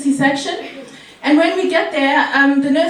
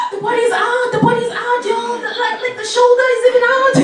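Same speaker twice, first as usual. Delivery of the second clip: much higher, rushing excited report; shocked excitement; 8 semitones higher, faster than usual. Retold or imitated. Cousin telling the car the baby is out, much higher and amazed. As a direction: blurt it out amazed and laughing.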